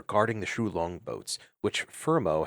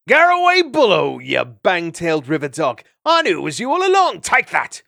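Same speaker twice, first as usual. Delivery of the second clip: mock battle yell; 9 semitones higher, 11 dB louder than usual. Scuffling in the water.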